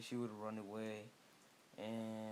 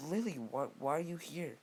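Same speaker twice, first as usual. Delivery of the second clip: raised-pitch, mildly acted surprise; higher, puzzled challenge to an unexpected visitor; surprised group question; 5 semitones higher, 4 dB louder than usual. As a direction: ask it as a startled crowd would. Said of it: "they would all say".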